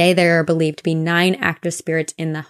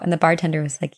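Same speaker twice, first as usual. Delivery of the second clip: ordinary talk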